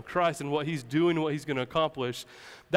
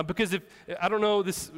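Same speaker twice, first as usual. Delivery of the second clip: ordinary talk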